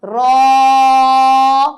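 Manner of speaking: را held out loud as a letter recital; drawn-out raa. Shown as a demonstration. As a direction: stretch را like a recitation teacher. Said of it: "اور آخری حرف تھا".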